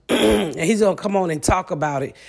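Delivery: usual speaking voice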